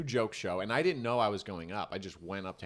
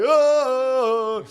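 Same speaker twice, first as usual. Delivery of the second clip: loud high mock gasp of shock; hammed-up crowd gasp; 16 dB louder than usual. Crowd gasping at joke.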